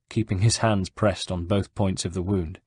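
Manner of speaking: ordinary delivery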